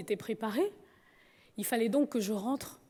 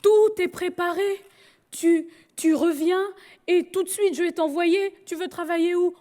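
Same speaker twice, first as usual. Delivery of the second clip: stern father; 6 semitones higher, 7 dB louder than usual. Retold or imitated. Father pressing his daughter to come home, loud and insistent. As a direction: raise the voice like a father laying down the law.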